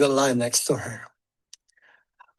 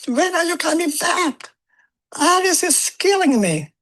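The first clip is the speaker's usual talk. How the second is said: a higher, pleading wife on the phone; wife calling; 14 semitones higher, 4 dB louder than usual. Retold or imitated. Wife pleads with husband to come home, higher voice. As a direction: imitate the wife's pitched-up plea. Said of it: "my wife would be calling".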